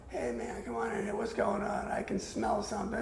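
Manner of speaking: lazy, buzzed welcome; rock star buzz. Retold or imitated. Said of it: "he goes into what I call rock star buzz".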